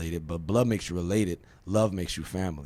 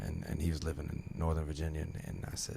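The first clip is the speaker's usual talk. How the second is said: ordinary talk, nothing marked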